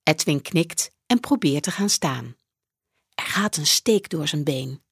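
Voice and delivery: ordinary delivery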